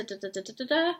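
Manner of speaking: ordinary delivery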